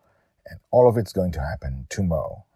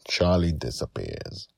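usual speaking voice

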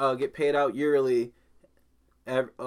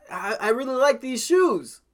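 high, bright mock shopper at the shoe rack; 8 semitones higher, 6 dB louder than usual. A customer talking to herself at the shoe rack, mock-hopeful. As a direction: play a hopeful customer spotting shoes.

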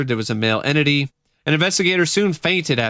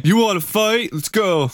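tough guy; 8 semitones higher than usual. Pretend. Joining the tough-guy bit.

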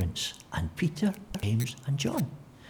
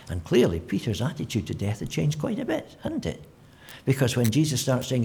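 ordinary talk, nothing marked

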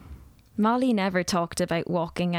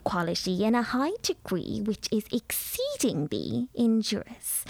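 lecturing lady; 3 semitones higher than usual. A narrator's reading. Mrs Dawson lectures the nervous girl about new tea, grandly. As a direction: pronounce on tea like an authority.

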